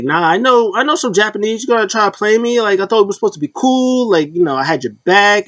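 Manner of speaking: high-pitched, hurt protest; indignant confrontation. Retold or imitated. Acting out Bayley confronting betraying friends.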